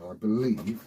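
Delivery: usual speaking voice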